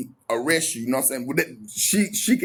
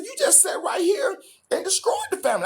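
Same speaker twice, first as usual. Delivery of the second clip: cracking accusation. Retold or imitated. Voice cracking at girlfriend.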